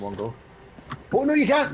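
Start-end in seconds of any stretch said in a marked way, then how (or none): 1.1-1.7 loud, high startled cry; real surprise; surprised shout. Real, not acted. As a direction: yelp as the jump fails. Friend falls in game.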